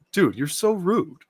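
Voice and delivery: laughing through the words, then a high laugh; amused laughing rebuke. Real, not acted. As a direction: crack up at the rudeness. Laughing at rude manga line.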